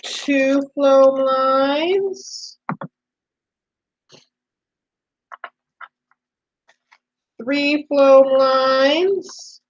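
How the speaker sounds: "floam lines" counted out very slowly; slow counting. Shown as a demonstration. Teacher counts the floam lines slowly for children. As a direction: draw out each count for the kids. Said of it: "So we have one floam line".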